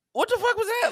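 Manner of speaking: high, loud startled exclamation; shocked outburst. Retold or imitated. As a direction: blurt it out, caught off guard by your own voice. Shocked at his own code switch.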